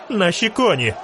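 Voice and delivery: high-pitched cry without extra volume; narrated call of alarm, pitched high; urgent cry. A narrator's reading. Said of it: "крикнула Мільва". (стривожений вигук високим голосом)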